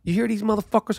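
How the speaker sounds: normal talking voice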